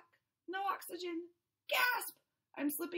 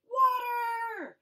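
higher, louder excited shout; joyful shout; 7 semitones higher, 8 dB louder than usual. Acted. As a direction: shout with sudden hope. Fish spots the toilet.

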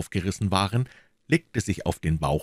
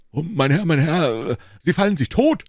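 much higher, louder frightened cry; alarmed cry; 10 semitones higher, 5 dB louder than usual. A narrator's reading. The servant crying out in fear as his master jumps, loud. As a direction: beg him not to jump. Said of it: "schrie Planchet".

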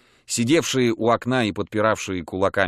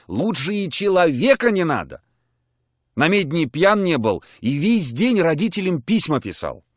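ordinary delivery, nothing marked

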